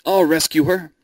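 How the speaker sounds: high, loud rallying cry; heroic shout. A narrator's reading. Suitor to aged father, a loud heroic shout. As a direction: shout it out to reassure everyone. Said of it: "shouted her sixth-rank suitor".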